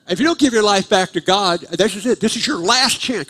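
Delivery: high, loud mock preacher's warning; imitating an insistent altar-call preacher; altar-call ultimatum. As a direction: press it on the room like a final warning.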